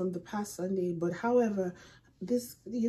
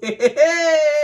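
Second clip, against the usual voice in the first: loud, high burst of nervous laughing; nervous laughter; 7 semitones higher, 9 dB louder than usual. Real, not acted. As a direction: laugh out loud from sheer nerves. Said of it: "this is laughter of fear and nervousness".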